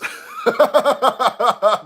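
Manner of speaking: high staccato cackle; high, helpless laugh at a silly joke; big laugh. Real, not acted. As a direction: crack up in a long run of ha-has. Laughs at chat's joke comment.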